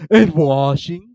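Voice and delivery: high, loud tearful voice; hammed-up tragic turtle; mournful Mock Turtle. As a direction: tell it like a weepy old turtle. Mock Turtle anxious question.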